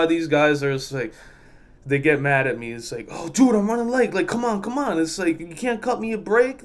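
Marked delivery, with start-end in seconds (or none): 3.1-5.0 high-pitched whiny plea imitation; mock pleading. Late trucker begging attendant, high mock pleading. 5.4-6.6 high-pitched imitated complaint; mock pleading. Late trucker asking attendant, high mock pleading.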